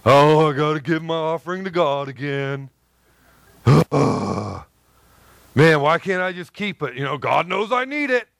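weary grumbling. Acted. A giver grumbling about God's offering, weary and put-upon. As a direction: moan like giving is a chore. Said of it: "they call it a weariness".

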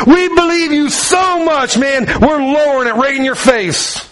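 high, earnest declaring; eager friends. Retold or imitated. Friends declaring faith to Jesus, high and eager. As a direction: pitch up, full of faith.

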